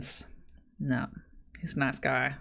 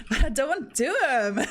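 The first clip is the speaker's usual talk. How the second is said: higher-pitched mock complaint, breaking into laughs; laughing protest; 7 semitones higher, 3 dB louder than usual. Jokey protest against good advice.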